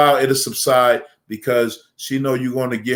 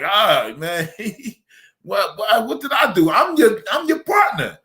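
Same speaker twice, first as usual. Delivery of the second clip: a hint of a higher, whiny "what did I do"; mock hurt coworker; 7 semitones higher than usual. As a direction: lightly play the offended partner. Coworker talking to him, mocked.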